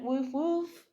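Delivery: light mock woof; understated imitation of a barking dog; imitated dog bark. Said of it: "and then Teddy went".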